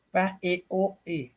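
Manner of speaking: "pa, e, o, e" read out as separate syllables; sounding out a misspelling. Retold or imitated. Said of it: "Leu e apontando".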